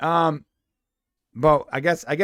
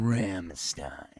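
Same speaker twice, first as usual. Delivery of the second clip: low, soft, drawn-out murmur; mock ASMR; 9 semitones lower, 6 dB quieter than usual. Cohost teasing about being turned on, low ASMR voice. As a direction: linger on the name, low and soft. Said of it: "let me try doing A S M R".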